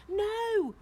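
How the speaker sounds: loud, very high refusal; crying out. A narrator's reading. Chaya refusing to leave, crying out. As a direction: cry out a long NO. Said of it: "cried Chaya".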